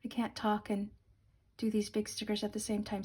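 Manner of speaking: talking while holding breath; strained, breath held in. Real, not acted. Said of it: "I'm holding my breath".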